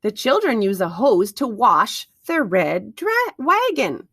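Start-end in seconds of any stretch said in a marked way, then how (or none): none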